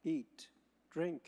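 ordinary talk

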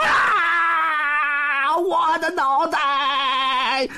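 high, drawn-out slow wail of pain; miserable howl. A narrator's reading. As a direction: howl over the lost head. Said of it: "那妖怪一声惨叫".

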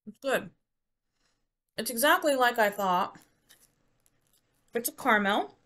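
chewing while talking; mouth full. Real, not acted. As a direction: talk through a mouthful of caramel. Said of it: "I'm still chewing the darn thing".

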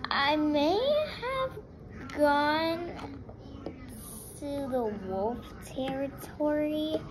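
slow, halting admission; hesitant confession. Acted. Child owning up to dad about wolf territory, slow and hesitant. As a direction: drag it out reluctantly, word by word.

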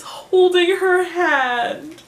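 high, drawn-out gushing squeal; swooning. Real, not acted. Fan swooning over a hand-holding line, high drawn-out gushing. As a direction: stretch it out as if melting with delight.

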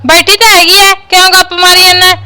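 high, loud nagging shout; loud scolding. Acted. Neighbour loudly scolding the son for his fib. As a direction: shout her down, needling him.

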